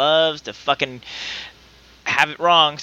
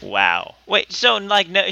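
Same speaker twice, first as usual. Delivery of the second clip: ordinary talk, nothing marked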